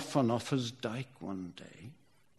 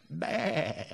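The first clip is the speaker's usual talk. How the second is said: high bleating baa; sheep bleat; 5 semitones higher than usual. Shown as a demonstration. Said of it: "That was Lancashire sheep counting".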